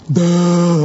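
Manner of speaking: drawn-out dopey "duh"; mock dim-witted Philip. Preacher mocking a second slow apostle, dopey drawl. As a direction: play a clueless disciple for laughs. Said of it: "Philip said to him".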